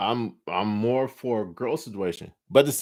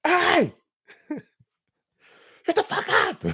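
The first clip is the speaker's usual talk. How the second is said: angry shout; 3 dB louder than usual. Acted. Yelling through the wall at the couple, furious high shout. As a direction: yell it furiously, much higher and louder.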